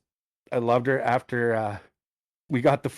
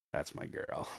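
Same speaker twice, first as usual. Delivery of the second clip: hushed, nearly whispered pride; quiet approval; 16 dB quieter, slower than usual. Retold or imitated. Dad watching daughter in the rearview mirror, quiet approval. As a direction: say it under your breath, proudly.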